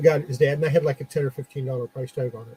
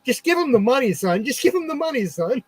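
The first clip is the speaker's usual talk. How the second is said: a touch of an insistent father, pitched higher; dad urging; 9 semitones higher, 5 dB louder than usual. Retold or imitated. Dad telling his son to pay the shop owner, mildly insistent. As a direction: nudge your son to just pay, lightly voiced. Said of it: "his dad's going".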